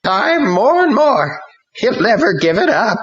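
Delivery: put-on a drunk spirit gloating, high and loud; drunken demon. A narrator's reading. Demon gloating drunkenly over Ron. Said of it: "The spirit laughed drunkenly".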